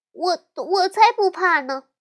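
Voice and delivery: high, loud little-sister protest; stammer, then protest loudly; defiant denial. A narrator's reading. Little sister teased by her brother, defiant denial.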